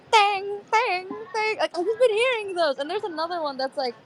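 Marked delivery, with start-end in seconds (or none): 0.1-1.6 high, ringing mock impact noises; imitated pan whacks. Describing an ASMR of getting hit with a pan, high imitated clangs. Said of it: "And then you see, like".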